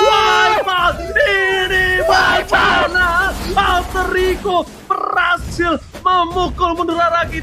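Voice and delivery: slow drawn-out hype shout; fighting game announcer. Real, not acted. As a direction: belt it like an arena announcer. Caster calls the wipeout. (teriakan penyiar pertarungan)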